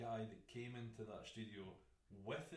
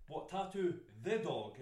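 slow, higher, louder disbelieving question; incredulous; 6 semitones higher, 9 dB louder, slower than usual. Retold or imitated. Tattooist retelling his reply to a dog-owner, incredulous. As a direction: drag it out in mock disbelief.

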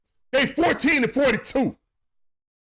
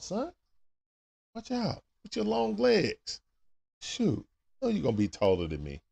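soft, low, gentle storytelling; 9 semitones lower, 5 dB quieter than usual. Real, not acted. Father softly telling his son how the caterpillar becomes a butterfly, tender. Talking to baby about caterpillar.

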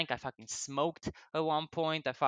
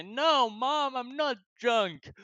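put-on high, slow, far-off drunk denial; a kid swearing to mom he's sober; 9 semitones higher, 5 dB louder, much slower than usual. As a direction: sing out the lie to mom, slowly and up high. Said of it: "And I say".

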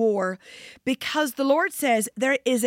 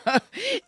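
light laugh; 11 semitones higher than usual. Real, not acted. Laughing at the comment.

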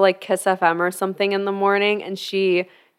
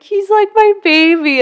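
put-on high, cooing baby voice; 12 semitones higher, 5 dB louder than usual. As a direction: coo it like talking to a puppy. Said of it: "your baby voice".

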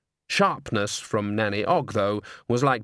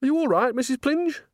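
mock concern. A narrator's reading. Nanny feigns concern.

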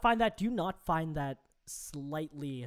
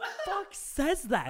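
incredulous; 8 semitones higher, slower than usual. Real, not acted. Disbelief at podcast quote.

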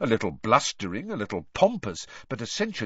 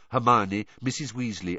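ordinary delivery, nothing marked

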